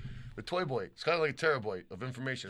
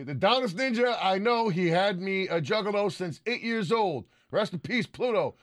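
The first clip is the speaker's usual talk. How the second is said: high, drawn-out announcer-like read; shout-out read; 7 semitones higher, 4 dB louder, slower than usual. Acted. Host reading a fan's tribute to the chat, high and emphatic.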